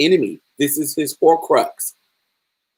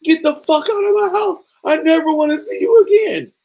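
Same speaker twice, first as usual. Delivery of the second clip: much higher, strained yell to get out; furious order; 13 semitones higher than usual. Retold or imitated. Son throwing his father out, high strained yell. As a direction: shriek it in a high voice.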